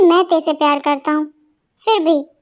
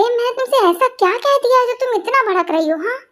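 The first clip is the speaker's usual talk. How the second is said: normal talking voice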